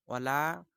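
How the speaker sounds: ওয়ালা with a drawn-out la; ওয়ালা, long final vowel. Shown as a demonstration. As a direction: stretch the la in ওয়ালা. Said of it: "লা লম্বা করতে হবে".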